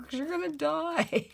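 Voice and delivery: very high, loud mock child wail; tearful child imitated. Retelling how she cried as a kid, high mock wail. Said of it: "I go".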